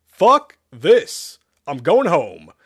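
high, loud outburst; loud refusal. Acted. Joe gives up and heads home, loudly fed up. Joe quits the fight in alarm.

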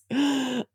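a quick excited gasp; sharp gasp. Real, not acted. Remembering riding his face, a sharp gasp.

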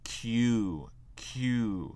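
Q said "kyoo", a K then "you"; K before "you". Shown as a demonstration. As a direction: model Q twice, drawn out, K plus y glide. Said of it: "mais un K avant".

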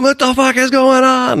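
very high, stretched-out mock alarm; buddies' bewildered cry. Three buddies in the pool calling him, imitated high bewilderment. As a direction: imitate baffled pals hollering. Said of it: "they're calling me, going".

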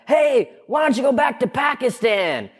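high, far-off heckler shout; imitating a stranger shouting; mock shout. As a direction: yell it across the street. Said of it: "this guy goes".

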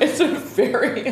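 normal talking voice

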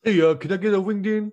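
quick, high customer bit; goofy customer voice. Acted. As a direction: rattle it off as a dopey customer. Customer voice in sketch.

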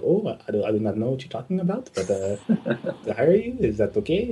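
put-on puzzled caller with a Spanish accent, rising at the ends. Confused Spanish caller repeating the job offer, imitated Spanish accent. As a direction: imitate a tentative Spanish speaker. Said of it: "So in Spain, they can't say".

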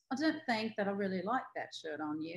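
a light put-on British accent, politely critical; mildly posh British accent for criticizing a friend. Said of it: "she'll also just go into a British accent".

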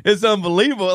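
ordinary delivery

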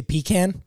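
pecan said as "PEE-can"; pecan starting "pee", ending like "can"; southern pecan. Shown as a demonstration. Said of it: "I like to go southern".

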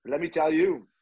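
put-on high, slow French-accented rant. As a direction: imitate an irate French coach. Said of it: "in his French accent".

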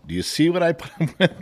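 wife pointing at the husband for the kids, mock exasperated. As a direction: imitate the wife through a laugh. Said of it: "she looks at all you kids and points".

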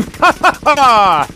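raider's triumphant laugh; villain laugh. Acted. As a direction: cackle in triumph over beaten foes. Raider gloats over beaten foes.

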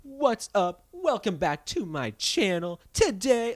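high, loud, slow vlogger greeting; fake YouTuber intro. Mocking YouTubers' fake intros, hammed-up vlogger greeting. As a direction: ham up a YouTube channel intro. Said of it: "fake intros are like".

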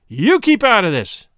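loud, high angry shout at her; husband turning fiercely on his wife, shouting angrily; fierce cry. Acted. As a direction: bark it at her to keep out. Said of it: "he cried".

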